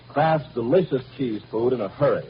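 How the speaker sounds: ordinary delivery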